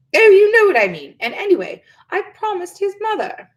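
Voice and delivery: high, loud, then fading; exasperated witch. A narrator's reading. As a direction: wave his point away. Witch brushes him off.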